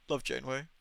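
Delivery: normal talking voice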